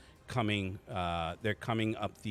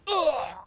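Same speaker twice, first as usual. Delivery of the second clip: loud, high cry on being hit; imitated pained grunt; 9 dB louder than usual. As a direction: let out a sudden pained "ugh". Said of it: "you can hear him like".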